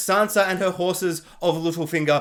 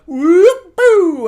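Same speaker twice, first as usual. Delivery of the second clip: high, loud hammed-up yell; cartoonish war cry; mock battle whoop; 9 semitones higher, 11 dB louder than usual. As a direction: whoop like a show-off swordsman. Mocking Halbrand's battle whoop.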